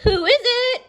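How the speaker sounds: a wolf sweetly faking Granny; sweetest Granny voice. Acted. The wolf in Granny's bed calls out in a sweet high granny voice. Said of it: "in his sweetest Granny voice".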